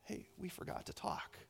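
hushed, tender whisper; very soft, intimate whisper; God's gentle whisper. Acted. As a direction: whisper it as a loving nudge. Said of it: "God just looks down and goes".

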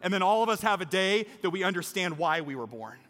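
rousing, emphatic sermon climax; impassioned preaching. Real, not acted. Preacher urges congregation toward baptism, impassioned preaching. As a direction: drive the appeal home to the whole room. Preacher builds to closing appeal.

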